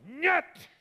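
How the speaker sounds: very high, excited burst on NYET; forceful nyet. Shown as a demonstration. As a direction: throw out the Russian no with force. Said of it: "It has some force".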